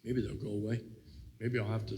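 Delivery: ordinary talk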